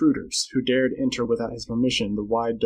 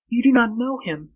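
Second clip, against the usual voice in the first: high female warning; anxious Flora; 11 semitones higher than usual. Acted. Girl warning Carl about Tarzan, anxious. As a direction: warn him in a high, urgent voice.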